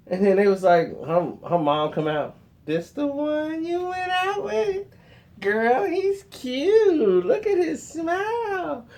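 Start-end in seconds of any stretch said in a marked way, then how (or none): 2.7-8.9 high, slow gushing mother voice; high drawn-out motherly gush; mom's voice. Retold or imitated. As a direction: coo over the daughter's date like an eager mom. Mom greets him, voiced high.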